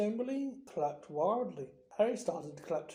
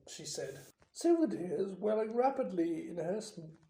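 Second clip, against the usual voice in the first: ordinary delivery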